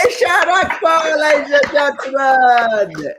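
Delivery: high, drawn-out MC shout; host announcement. Real, not acted. Host sending off the comedian to applause, big slow announcement. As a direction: stretch the name out for applause.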